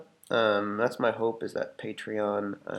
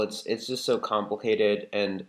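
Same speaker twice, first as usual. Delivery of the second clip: ordinary delivery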